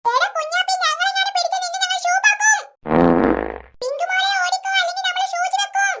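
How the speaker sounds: put-on high-pitched child figure teasing; playful threat. Children warning playmates to run, playful threat.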